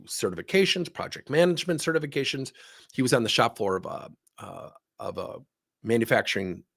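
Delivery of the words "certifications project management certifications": ordinary delivery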